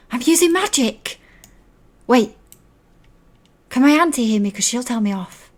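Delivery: put-on high, loud child's outburst; excited child. Child answering magic message instantly, high excited blurt. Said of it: "there's, like, no hesitation".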